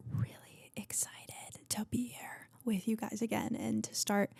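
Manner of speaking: excited whisper; soft breathy whisper of excitement. Real, not acted. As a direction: whisper it like a secret thrill.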